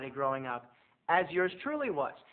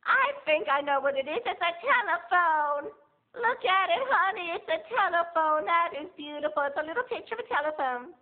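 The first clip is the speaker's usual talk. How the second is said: grandma voice; 12 semitones higher, 4 dB louder than usual. Retold or imitated. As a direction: coo like a sweet confused grandma. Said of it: "and she said".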